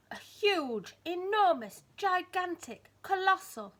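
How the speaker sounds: loud, high, each word falling heavily; big booming words. A narrator's reading. Storyteller to child, booming each size word. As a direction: boom out every big word.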